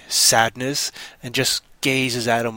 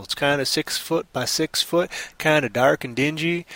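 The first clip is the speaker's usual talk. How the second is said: usual speaking voice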